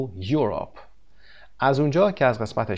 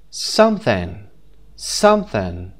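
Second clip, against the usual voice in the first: "Something" with SOME punched; SOME-thing, louder opening syllable; stress on first syllable; 2 semitones lower, 4 dB louder, much slower than usual. Shown as a demonstration. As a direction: hit the start of "Something", let the end fall. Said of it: "The first syllable".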